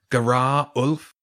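slow, a hint of the badger king's voice; echo of the badger. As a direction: lightly recall how the badger said the name. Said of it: "That was the voice".

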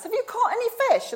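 high, fast call across the water; a stranger on the shore calls out to the fishermen. Retold or imitated. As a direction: shout it to the boat from the shore. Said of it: "And this person shouts".

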